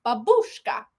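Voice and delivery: one word in badly faked Russian; attempted Russian accent. Practising for a babushka act, attempted Russian accent. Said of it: "How do I do a Russian accent?".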